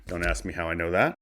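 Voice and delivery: ordinary delivery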